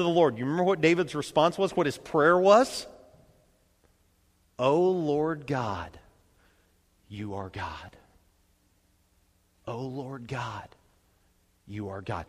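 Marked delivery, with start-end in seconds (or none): 4.6-12.2 hushed, softer worship; bow low and confess it softly; reverent. Retold or imitated. David's prayer recalled.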